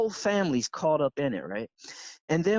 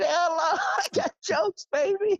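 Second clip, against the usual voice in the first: gleeful brag. Real, not acted. Bragging about his joke.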